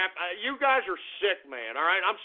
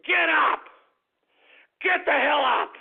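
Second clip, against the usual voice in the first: angry shout; 6 semitones higher than usual. Acted. Radio host shouting at his listeners to get up, furious yell. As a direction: yell it at the listeners like a drill sergeant.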